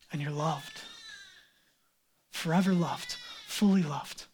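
soft, low, tender voice; tender assurance. Acted. The Father tells his adopted child they're loved, tenderly.

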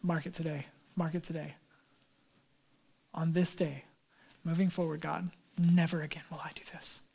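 low, soft, earnest vow, whispered at the end; hushed promise to God, low and earnest; solemn vow. Retold or imitated. Believer quietly vowing to God never to sin again.

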